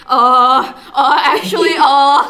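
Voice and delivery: loud, hesitant fillers, half laughing; flustered stammer. Retold or imitated. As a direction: fumble for words out loud. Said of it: "And so we're like".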